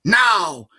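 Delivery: high, loud command; sharp order. A narrator's reading. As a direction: snap the order out. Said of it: "she ordered".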